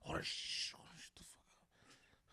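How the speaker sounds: hushed, slow whisper; retold whisper. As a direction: whisper urgently so no one hears. Retold whisper during sex scare.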